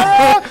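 high loud frightened yelp; high scared cry. Retold or imitated. Imitating his daughter's yelp as the lap bar locks. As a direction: yelp like a kid as the bar clicks shut. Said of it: "E ela".

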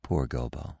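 very low, hushed echo; soft echo. A narrator's reading. Bambi echoing Feline's pity for Gobo, softly. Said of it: "Bambi repeated".

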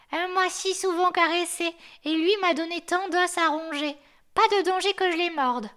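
high, put-on voice for the old dog; the hoarse yard dog lecturing the newcomer; grumpy old guard dog. The chained dog explains sun and moon to the snowman, in a high put-on voice.

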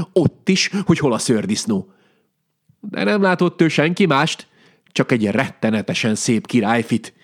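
ordinary delivery, nothing marked

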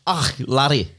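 a quick Scottish lilt; light stage Scottish; Scottish accent. Pretend. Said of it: "do the underclass in Scottish".

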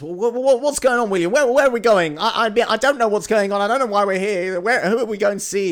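loud, high, fast stammering panic; panicked fear. Acted. Frightened Harry quizzing William in the dungeons, hammed-up panic. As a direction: babble frantically, tripping over repeated words. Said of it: "it's given him the fear".

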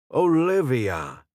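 put-on slight British accent. A narrator's reading. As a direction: hail her slowly in a light British accent. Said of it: "he said with a slight British accent".

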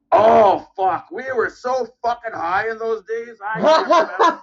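Jake the Snake impression. Jake answers fan questions in a high, slow, laughing stoner voice. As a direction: ham up a spaced-out old wrestler, laughing through it. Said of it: "And he's just".

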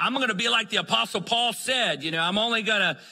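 high, loud, self-mocking zeal; zealous young convert. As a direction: ham up your overeager younger self. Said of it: "and I thought".

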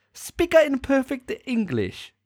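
very high, slow Italian accent slipping towards Chinese; mock Italian accent. Shown as a demonstration. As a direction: overdo the Italian until it wobbles off course. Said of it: "That's getting a bit Chinesey there".

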